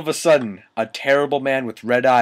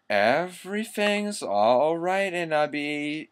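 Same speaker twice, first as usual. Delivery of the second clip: high, slow, soft put-on tenderness; sensitive voice; 6 semitones higher, 4 dB quieter, slower than usual. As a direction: coo it slowly, with hammed-up sensitivity. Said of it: "all sensitive".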